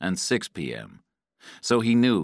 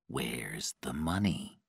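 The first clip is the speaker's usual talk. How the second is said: low, soft and slow; calm questioning; 4 semitones lower, 9 dB quieter, slower than usual. A narrator's reading. Guy waits for Patrick to recover, asks calmly. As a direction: ask calmly, with quiet control. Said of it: "Then he asked calmly".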